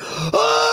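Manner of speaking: high shocked cry; electrocuted yelp. Retold or imitated. Brother grabbing the electric fence, high pained cry. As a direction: yelp as the current hits. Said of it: "he was like".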